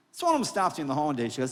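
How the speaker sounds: usual speaking voice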